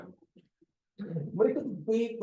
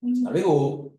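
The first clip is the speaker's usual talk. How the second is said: curt, unpleasant Assalamualaikum, a bit louder; hurtful intonation; 3 semitones higher, 4 dB louder than usual. Shown as a demonstration. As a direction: say Assalamualaikum so it stings. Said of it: "Tapi intonasinya tak sedap, menyakiti".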